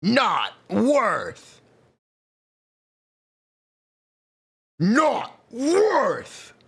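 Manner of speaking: high, drawn-out verdict call; announcer voice. Acted. Recording voice clip takes.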